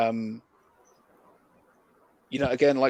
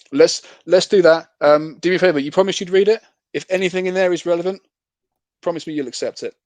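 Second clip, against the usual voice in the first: excited; 6 semitones higher, 7 dB louder than usual. Real, not acted. Enthusiastic close of sales call.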